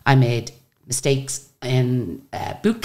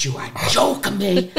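slow, higher, gasped incredulity; retold disbelief; 5 semitones higher, slower than usual. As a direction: draw it out in mock shock. Retold shock at learning who she was.

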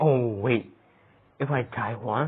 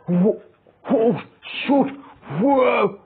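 high, loud frightened whoas; startled yelps; 10 semitones higher, 5 dB louder, slower than usual. Real, not acted. Gamer narrowly escaping the monster, crying out. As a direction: gasp out the near miss. Near miss in horror game.

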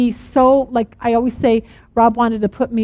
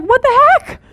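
indignant disbelief; 8 semitones higher than usual. Retold or imitated. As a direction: play it hurt and baffled, half joking. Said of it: "And Bill's like".

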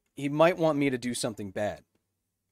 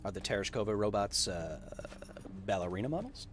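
normal talking voice

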